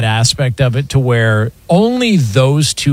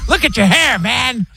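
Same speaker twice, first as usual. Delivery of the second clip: higher mocking dad voice; dad impression; 11 semitones higher than usual. Son imitates his dad mocking his hair, pitched up. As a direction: sneer at the kid's hair. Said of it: "Do the impression".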